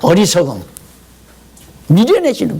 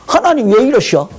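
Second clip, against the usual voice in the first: higher mock reproach to God; resentful complaint; 7 semitones higher than usual. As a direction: grumble at God in disbelief. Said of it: "하나님께 전폭 의지하는 마음이 있는 것이 아니라". (원망 섞인 투정)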